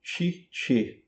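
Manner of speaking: "Cice" with two soft ch sounds, like chee-cheh; Italian pronunciation. Shown as a demonstration. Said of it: "Eles falam Cicerone".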